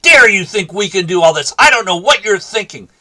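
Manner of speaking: high, loud outburst at the teacher; a student's loud protest; retold angry shout. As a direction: snap back hard, indignant. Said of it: "slams his hand down on the desk and shouts".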